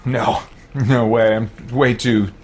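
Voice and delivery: ordinary delivery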